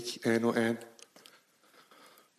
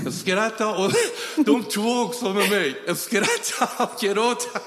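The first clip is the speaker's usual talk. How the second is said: laughing while telling it, higher; 7 semitones higher, 4 dB louder than usual. Retold or imitated. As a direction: laugh through the words, slowing down. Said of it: "jag börjar gråta". (skrattande, högre röst)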